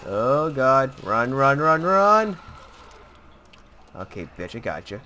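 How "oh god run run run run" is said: panicked, urging himself to run; frantic yelling while fleeing; being chased. Real, not acted. Fleeing enemies in game.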